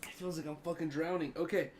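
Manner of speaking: gasping between words after coughing; breathless, talking through the burn; out of breath. Real, not acted. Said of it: "let me catch my breath".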